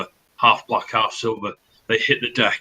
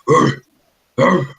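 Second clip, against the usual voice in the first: two short, raised orc growls; orc grunts; 6 semitones higher, 7 dB louder than usual. Acted. Restless orc alerting the deck, short loud growls. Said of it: "And he just goes".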